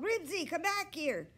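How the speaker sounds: high loud call of the dog's name. A narrator's reading. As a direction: call the dog back down the street. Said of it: "he called".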